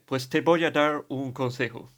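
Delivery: put-on Spanish spoken slowly with an English accent; a British priest giving advice in Spanish. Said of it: "Pongo este acento porque es el que tenía él". (castellano con acento inglés, despacio)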